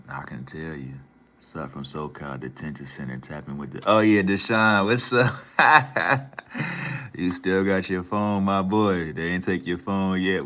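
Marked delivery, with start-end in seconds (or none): 3.8-5.3 loud, high burst of recognition; excited greeting. Real, not acted. Streamer spots Deshaun in the chat, excited shout. 5.4-7.1 loud, high burst of laughter; delighted laugh. Real, not acted. Streamer cracks up at Deshaun turning up, loud laugh. 7.1-10.4 loud, raised, amused hollering; excited teasing. Real, not acted. Streamer teases detained friend about his phone, loud and excited.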